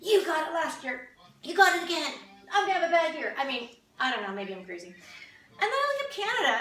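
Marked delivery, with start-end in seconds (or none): 0.0-3.3 louder, higher, whiny grumble; mock sibling complaint. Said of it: "I can see infighting coming from this". 4.9-6.6 laughing. Real, not acted. Laughs starting the Canada bit.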